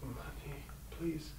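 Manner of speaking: near-whispered plea to a pet; barely-voiced plea to the dog; hushed plea to dog. Real, not acted. Shooing the dog off again.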